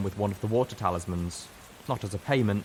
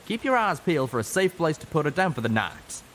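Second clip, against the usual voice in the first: raised, higher voice calling over the rain; calling back; 8 semitones higher, 4 dB louder than usual. A narrator's reading. Calamity calls back to the others over the rain, loud. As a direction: call it back over a roaring storm. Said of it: "Calamity called back over the roar of the rain".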